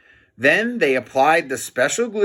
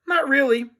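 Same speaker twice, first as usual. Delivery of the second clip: put-on high kid voice; 11 semitones higher than usual. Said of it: "said George".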